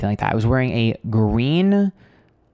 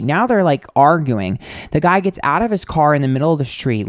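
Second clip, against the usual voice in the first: normal talking voice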